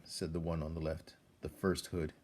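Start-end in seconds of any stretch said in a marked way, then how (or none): none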